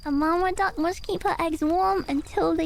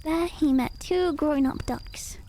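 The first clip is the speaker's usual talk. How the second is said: usual speaking voice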